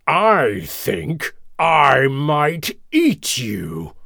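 slow, drawn-out lion threat; menacing King Lion. A narrator's reading. Lion threatening the little mouse, slow menacing growl. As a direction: growl it slowly, toying with prey. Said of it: "King Lion replied".

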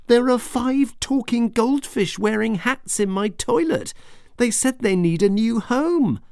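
put-on high breathless child voice; excited child. Bella telling her parents about the fish, excited. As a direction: blurt it out to the parents.

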